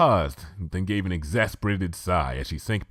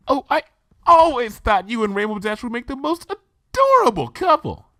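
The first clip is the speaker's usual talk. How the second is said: Rarity, delighted; 8 dB louder than usual. Acted. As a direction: gush like a thrilled fashionista. Rarity voiced by reader.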